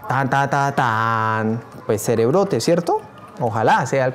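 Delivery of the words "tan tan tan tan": voiced fanfare with a long last note; drumroll-style "ta-da" fanfare. Real, not acted. Teacher revealing the correct answer, voiced fanfare. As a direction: chant a little reveal jingle.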